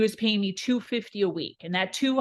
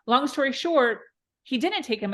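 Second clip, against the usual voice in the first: ordinary talk, nothing marked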